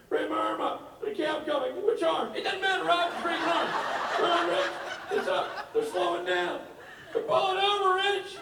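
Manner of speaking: high, loud mock panic; panicked Rob. Friend narrating the cab to Rich, high and frantic. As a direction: shriek it like a lost drunk friend.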